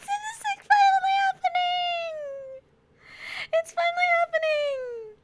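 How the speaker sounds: high-pitched, teary squeal of joy. Real, not acted. Streamer telling chat it's finally here, squealing. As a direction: squeal it like a dream come true. Streamer overjoyed at long-awaited event.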